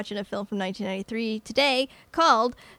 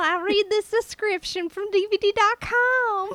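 put-on Scottish accent; 9 semitones higher than usual. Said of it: "You gotta do it with a Scottish accent".

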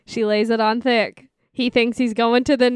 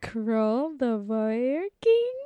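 teasing coaxing; 4 semitones higher than usual. Pretend. Teasing the nicknamed warrior king.

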